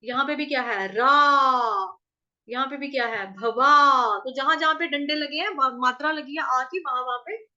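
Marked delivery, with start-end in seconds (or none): 0.9-2.0 रा loud, with a long held vowel; raised long aa. Shown as a demonstration. Teacher shows where to rise, loud long रा. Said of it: "ऊंचा उठना है". 3.3-4.2 भवा with a loud, long वा; raised long aa. Shown as a demonstration. Teacher marks the long vowel, loud held भवा. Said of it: "ऊंचा उठना है".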